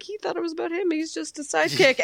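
high, loud mock hero jeer; Superman yelling. Fan retelling the heckle in the theater, high mock yell. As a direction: imitate a gleeful hero calling out a put-down. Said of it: "Superman yells something about".

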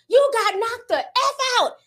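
high, drawn-out jeer; mocking clowning. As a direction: imitate the crowd roasting him. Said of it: "they be clowning him".

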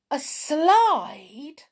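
high, loud disbelief after a gasp; astonished cry. A narrator's reading. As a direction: cry it out, hardly believing your ears. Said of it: "cried Joe, hardly believing his ears".

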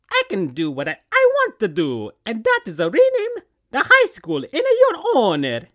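rising high, loud Mario principal. Acted. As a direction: build into a big proud announcement. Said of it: "I chose Mario for the principal".

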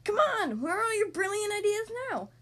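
loud, high-pitched angry demand. A narrator's reading. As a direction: demand answers like an impatient king. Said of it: "the king demanded".

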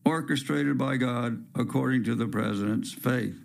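plain reading voice

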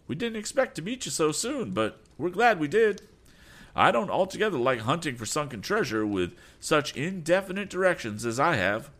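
high, gloating rival voice; smug rival. Acted. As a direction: toss it off smugly. Berg's high taunting voice.

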